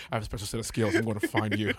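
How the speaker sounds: low, measured movie-tough-guy threat; Liam Neeson. Acted. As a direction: do the action hero's phone threat, pitched low. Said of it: "It's like Liam Neeson".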